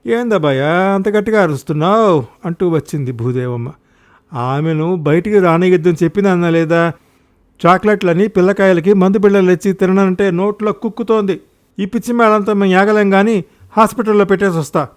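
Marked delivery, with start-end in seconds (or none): 0.1-2.2 startled questioning. Acted. Mother responds to son's shout. 4.3-14.9 high, loud exasperated outburst; angry complaint. Acted. Son complains angrily to mother.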